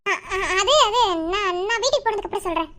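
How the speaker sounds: stumbling, hesitant start, then hurried; flustered stammer. Acted. Wife avoids husband's interview question, flustered stammer. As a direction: falter and dodge the question. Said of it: "ஏன் ஒரு மாதிரி தடுமாறுற".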